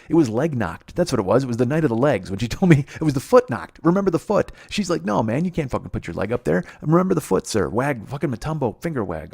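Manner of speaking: loud, worked-up mocking tirade; pissed-off rant. Real, not acted. Man mocking the usher's foot warning, pissed off. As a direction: rail at it loudly, mocking the scolding. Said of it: "Oh, I'm pissed".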